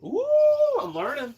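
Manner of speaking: high squeal then high little voice; squeaky delight. Pretend. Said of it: "Let's see if I can repeat it".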